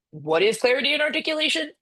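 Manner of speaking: "clarity and articulation" pitched high in sarcastic disbelief; mocking question. Real, not acted. Lecturer mocking the company's vague jargon, sarcastic and high. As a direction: scoff at the buzzwords "clarity and articulation" with a high, incredulous lilt.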